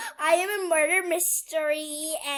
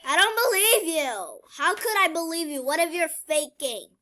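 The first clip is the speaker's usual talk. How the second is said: loud, higher, disbelieving; loud accusing; 3 semitones higher, 7 dB louder than usual. Pretend. Accusing the suspect in the game, loud. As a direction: throw the accusation loudly.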